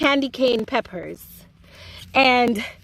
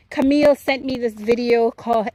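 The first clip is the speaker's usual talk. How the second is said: ordinary talk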